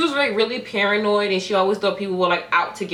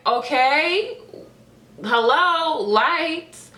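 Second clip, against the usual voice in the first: higher, questioning call into a dark room; 7 semitones higher, much slower than usual. Retold or imitated. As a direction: call out for someone to turn the lights on. Calling out in dark office.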